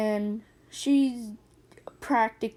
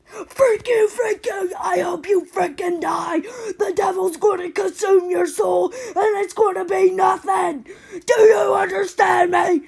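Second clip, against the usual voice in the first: high, loud, tearful rage; yelling curses at the neighbour kids, crying with fury; furious screaming; 7 semitones higher, 9 dB louder than usual. Retold or imitated. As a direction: scream it through angry tears. Screaming at neighbours over brick.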